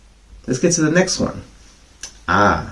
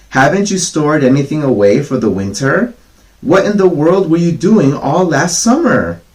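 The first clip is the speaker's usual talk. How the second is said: higher, indignant scolding; scolding ants; 6 semitones higher, 4 dB louder than usual. Acted. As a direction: scold the lazy grasshopper from above.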